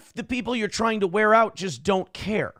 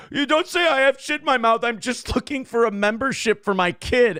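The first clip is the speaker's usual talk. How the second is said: offended caller; 6 semitones higher than usual. Retold or imitated. Caller protests, imitated.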